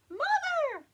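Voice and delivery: very high loud shout; chick shouting. Acted. Little chicken shouts for its mother. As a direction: call out for mother at full voice. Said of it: "heard the little chicken shout".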